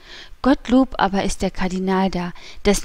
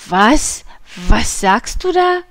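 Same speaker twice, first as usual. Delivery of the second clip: outraged disbelief; 2 semitones higher, 5 dB louder, slower than usual. A narrator's reading. Husband accused of selling his soul, outraged. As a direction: explode at the insult, voice climbing. Husband reacts to being insulted.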